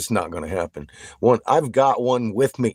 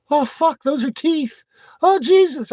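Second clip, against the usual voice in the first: much higher, startled cry of discovery; stunned excitement; 4 dB louder than usual. Retold or imitated. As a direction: yelp it out like you just struck gold. Retold shock at finding teeth.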